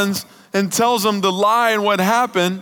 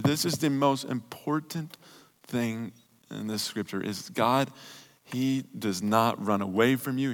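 much lower and softer, tender; intimate preaching; 9 semitones lower, 10 dB quieter than usual. Real, not acted. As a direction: draw them in quietly, tender sermon close. Sermon climax drops to intimate tones.

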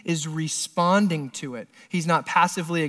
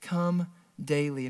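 gentle plea; 3 semitones lower, 8 dB quieter than usual. Pretend. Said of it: "He's saying".

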